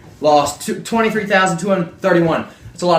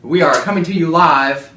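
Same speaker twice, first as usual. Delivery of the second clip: news anchor; 3 dB louder than usual. Pretend. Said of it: "This is a newscast".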